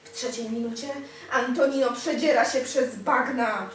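usual speaking voice

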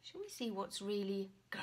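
ordinary delivery, nothing marked

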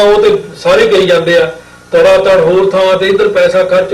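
normal talking voice

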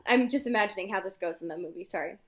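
ordinary talk, nothing marked

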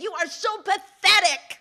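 high, loud contempt; fed up with the begging; scornful exasperation. Acted. Exasperated snake to begging man.

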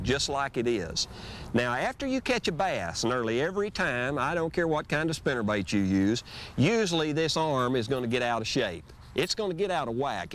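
normal talking voice

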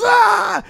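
high, loud scream; imitating a startled victim's yell; retold terrified scream. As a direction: scream as if jumped from behind. Acts out friend's scream when jumped.

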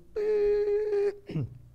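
high choked sob in the throat; real choked-up sob; choked cry. Real, not acted. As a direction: catch in the throat as the grief surfaces. Choked up recalling father's death.

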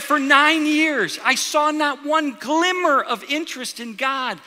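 slow, high, ringing retold testimony; emphatic testimony. Sister marvels over her brother to the pastor, slow, high and emphatic. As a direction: draw each phrase out, pitched high and insistent.

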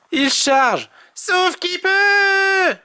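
high alarm cry, stretching long at the end; yelling Tom. A narrator's reading. Tom warns of the charging rabbits, high panicked yell. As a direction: cry the alarm high, drag out the last words. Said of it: "hurle Tom".

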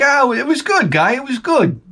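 high-pitched mock coworker; coworker impression voice. Coworker reviews the Happy Days dinner, high mock voice. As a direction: imitate a guy who calls everyone Guy. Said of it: "He's like".

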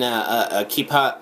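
ordinary delivery

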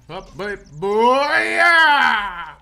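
rising whoop on a long "yeah"; building hype shout. Real, not acted. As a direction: wind up into a big, drawn-out yell. Hyping a punch in play.

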